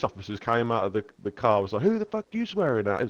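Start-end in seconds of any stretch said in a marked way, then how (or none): 1.8-3.0 high-pitched indignant retort; retold police outburst, pitched well up; mock angry challenge. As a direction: act out an affronted cop. Police officer challenges the man.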